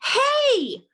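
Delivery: high, loud startling "hey"; loud call. Acted. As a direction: call out sharply to get attention. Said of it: "a loud voice said".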